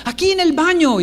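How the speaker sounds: high, urgent call from another room; hammed-up high-pitched shout; imitated child's yell. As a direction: holler where you are like a panicked kid. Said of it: "me asusto porque está gritando".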